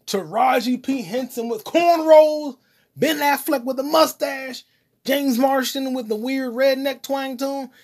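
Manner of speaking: slow, high, excited listing. Real, not acted. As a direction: savor each cast name with excitement.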